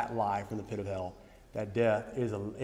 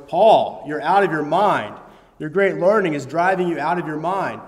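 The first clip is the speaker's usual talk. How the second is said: raised, loud voice calling Paul mad; loud retold outburst; 8 dB louder than usual. Festus shouting at Paul during his defense, loud. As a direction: boom it out like an exasperated judge. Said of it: "Festus said in a loud voice".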